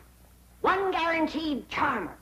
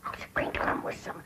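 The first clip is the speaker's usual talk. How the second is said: whispered aside; 6 dB quieter than usual. Real, not acted. Secret aside.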